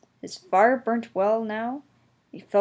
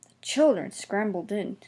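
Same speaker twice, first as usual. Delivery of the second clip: usual speaking voice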